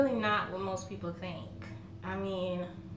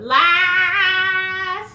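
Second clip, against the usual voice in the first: a playful sung cry of "lies"; loud sing-song exclamation; 10 semitones higher, 14 dB louder than usual. Acted. Calling out the family's fib about grandma's smoking, sung loudly. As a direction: belt it out, much higher and louder.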